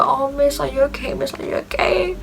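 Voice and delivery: high, soft imitation of fussing pupils; mock concern. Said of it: "they ask her".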